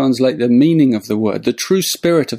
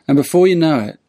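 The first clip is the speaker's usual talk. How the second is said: ordinary delivery, nothing marked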